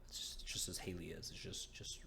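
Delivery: hushed, breathy whisper. Real, not acted. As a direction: drop to a whisper. GM describes sign, whispering.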